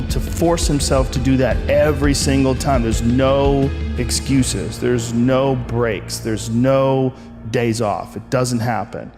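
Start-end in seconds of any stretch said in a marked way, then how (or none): 2.8-8.2 each line very slow and deliberate; slow emphasis. Real, not acted. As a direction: spell out every rule, slowly. Listing discipline rules, very slow.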